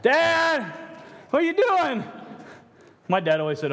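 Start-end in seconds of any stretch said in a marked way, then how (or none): 0.0-2.0 put-on high, loud kid calling through the door; a small child whining for dad, much higher and louder. Child at the office door calling to Dad, high and loud.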